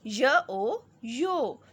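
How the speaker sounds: put-on slow, drawn-out letter by letter reading for children; slow spelling drill. Shown as a demonstration. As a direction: sound out each letter slowly, then blend. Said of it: "same इसी तरह reading करके".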